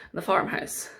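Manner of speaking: ordinary delivery, nothing marked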